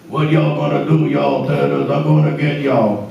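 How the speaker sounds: loud Cajun gator growl; Leatherhead voice. Acted. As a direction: snarl at the turtles, Cajun style. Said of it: "Leatherhead on Teenage Mutant Ninja Turtles".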